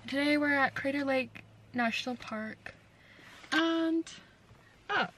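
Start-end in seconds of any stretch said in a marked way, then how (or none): none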